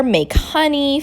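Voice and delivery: plain reading voice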